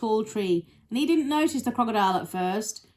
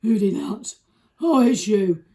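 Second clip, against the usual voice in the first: loud, booming big elephant; Trunky; 2 semitones higher, 8 dB louder than usual. Acted. Elephant confronting the crocodile, big and loud. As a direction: boom it out like a huge animal. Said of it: "said Trunky in his big".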